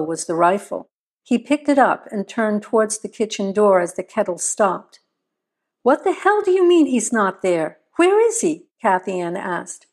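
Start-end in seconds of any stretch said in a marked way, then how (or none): 5.8-8.7 raised, higher-pitched questioning; alarmed demand. A narrator's reading. Wife on the phone about the missing man, alarmed demand. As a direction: demand answers, pitch jumping up.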